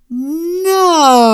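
very loud, high, drawn-out roar. A narrator's reading. Mama stops zookeepers caging her cub, furious roar. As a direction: roar it with full force. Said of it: "Mama roared".

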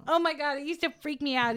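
ordinary talk

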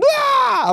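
sudden high-pitched mock wail; imitated baby wail. Baby daughter waking exhausted dad, imitated high wail. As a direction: mimic a baby crying out in the night.

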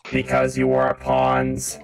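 deep, slow gloating villain; robot villain taunt. Acted. The robot repeats his taunt to the player, deep and gloating. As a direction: sneer it down from above.

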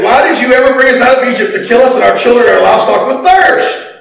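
loud, high, fast accusing protest; grumbling complaint. A narrator's reading. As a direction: complain at Moses, voice climbing on the last words. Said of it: "and grumbled against Moses".

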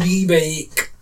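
high, put-on Scottish voice; Scottish accent. Shown as a demonstration. Asked for a Scottish accent, high comic Scottish. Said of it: "You could do a Scottish accent".

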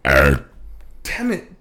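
words pushed out on a burp. Real, not acted. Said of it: "No, don't burp in the mic".

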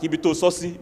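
ordinary talk

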